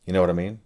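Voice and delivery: know pushed up with a small rise at the end; stress on know. Shown as a demonstration. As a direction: lean on "know" in "You know what I mean?". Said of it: "I change the meaning subtly by changing the emphasis".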